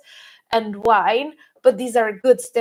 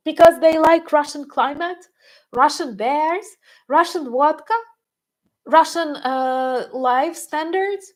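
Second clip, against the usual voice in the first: higher pitch, each item rising like a question; sarcastic questioning; 5 semitones higher than usual. Acted. Mocking reasons for escaping to Russia, sarcastic questioning. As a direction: offer each absurd reason as a mock guess. Mock answers to her own question why.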